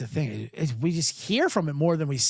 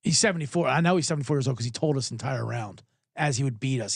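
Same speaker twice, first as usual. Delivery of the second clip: ordinary talk, nothing marked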